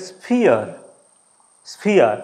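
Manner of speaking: "স্ফিয়ার" with ই gliding into আ, falling; ia diphthong demo. Shown as a demonstration. Teacher shows students the ia sound in sphere, gliding slowly downward. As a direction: draw ই into আ in স্ফিয়ার. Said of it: "ইয়া উচ্চারণ কীরকমভাবে হচ্ছে?".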